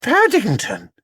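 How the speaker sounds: high, bright cry of the name; a child's startled call; exclaiming. Acted. Judy spotting Paddington, high exclamation. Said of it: "exclaimed Judy".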